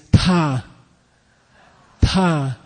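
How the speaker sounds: 'ta' with a big puff of breath; breathy aspirated tone. Shown as a demonstration. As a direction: sigh out 'ta' like you're tired. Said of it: "like you're tired".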